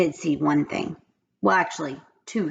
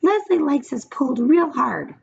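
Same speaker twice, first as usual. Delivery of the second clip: pigtail voice; 8 semitones higher than usual. A narrator's reading. The pigtail coaxes Paul in a high little voice. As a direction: coax him like a tiny tempter. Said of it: "said the pigtail".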